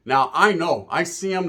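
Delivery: usual speaking voice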